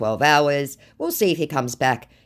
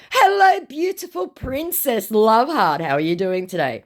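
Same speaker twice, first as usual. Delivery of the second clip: [name] scammer; 10 semitones higher, 4 dB louder than usual. Retold or imitated. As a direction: gush as a flattering scammer, then settle. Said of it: "meet another [name]".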